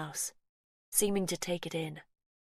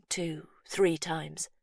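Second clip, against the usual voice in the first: usual speaking voice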